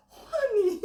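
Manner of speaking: mommy voice. Retold or imitated. Listener's mommy instincts kick in for the poor man, motherly. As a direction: coo it like a doting mother, falling away. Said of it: "mommy instincts go".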